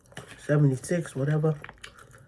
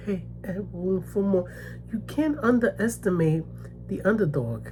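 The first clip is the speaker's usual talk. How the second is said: ordinary delivery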